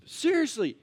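usual speaking voice

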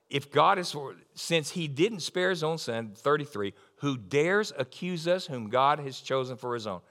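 normal talking voice

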